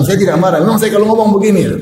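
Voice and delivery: high, laughing protest; mock denial, high and amused; defensive denial. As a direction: insist it's just your voice. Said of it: "Tapi dia bilang".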